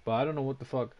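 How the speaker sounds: ordinary talk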